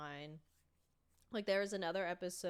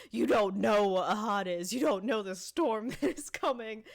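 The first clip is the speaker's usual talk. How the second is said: much louder, slow, unhinged yell; mock screaming; 2 semitones higher, 8 dB louder than usual. Naked Dennis yelling at neighbor about heat, hammed-up scream. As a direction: rant at the neighbor like a madman. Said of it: "just starts screaming".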